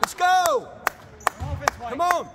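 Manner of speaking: cheering shout. Real, not acted. Parent cheering at basketball game.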